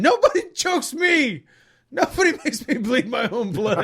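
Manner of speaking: loud, high-pitched mock screaming; retold outburst, high and loud; imitated scream. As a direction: imitate a furious fighter screaming. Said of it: "starts screaming".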